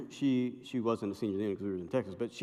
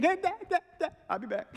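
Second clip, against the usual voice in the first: put-on much higher, girlish call; daughter's voice. Daughter calling to her dad as she runs off, high. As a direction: chirp it like a teen daughter.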